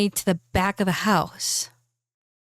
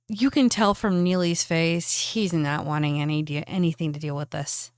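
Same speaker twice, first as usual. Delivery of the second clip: usual speaking voice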